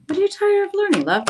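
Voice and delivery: what are you tired of, love, high and incredulous; surprised question. Real, not acted. Reader to a viewer tired of learning, incredulous high question. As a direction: pitch leaps up in disbelief.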